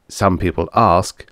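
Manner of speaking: usual speaking voice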